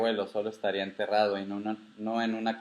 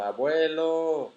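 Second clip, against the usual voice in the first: long, high drawn-out call for grandfather; 6 semitones higher, 3 dB louder, much slower than usual. A narrator's reading. Grandchild searching the cavern for grandfather, calling out. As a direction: shout it out, stretching the word. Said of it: "caminé gritando".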